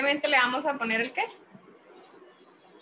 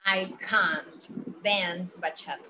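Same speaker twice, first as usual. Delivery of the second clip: slow, "can't" with its T; slow model sentence; 4 semitones lower, much slower than usual. Shown as a demonstration. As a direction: read it slowly, marking "can't". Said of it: "la pronunciación es muy importante".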